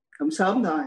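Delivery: normal talking voice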